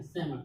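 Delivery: सेमन with no L sound; silent L. Shown as a demonstration. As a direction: say सेमन as "se-man", skipping the L. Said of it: "कितने का हम लोग pronunciation किए".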